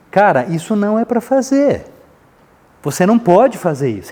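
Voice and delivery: acted stern correction, forceful and direct; firm reprimand. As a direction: set the limit emphatically. Acted adult setting a limit.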